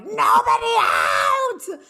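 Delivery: shrill panicked shout of nobody out, stretched long; yelling. Retold or imitated. Mother in the supermarket orders nobody to leave, shouting. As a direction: bellow it to the whole store in panic. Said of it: "I started yelling".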